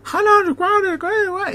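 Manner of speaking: high squawking mock-English gabble; incomprehensible cackle. Teacher speaking English to a lost new pupil, squawky gibberish. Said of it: "yo escuchar un cacareo incomprensible".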